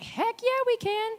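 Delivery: very high-pitched gung-ho reply; hammed-up, overconfident yes; mock eager. Disciples naively answering Jesus's challenge, hammed-up eagerness.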